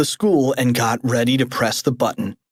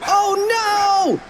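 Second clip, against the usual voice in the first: very high wail; squealed dismay; alarmed cry. Acted. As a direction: wail it like a terrified cartoon kid. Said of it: "cried Cheeseball".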